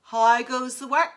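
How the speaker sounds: loud bossy farmer call; lazy farmer bellowing from bed. Acted.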